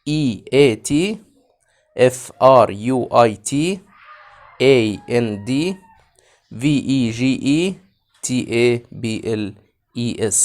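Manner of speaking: each letter said slowly and separately; slow spelling. Shown as a demonstration. Teacher spelling a phrase for kids, slow letters. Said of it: "spelling letters".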